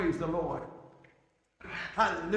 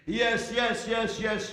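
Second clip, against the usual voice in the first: ordinary talk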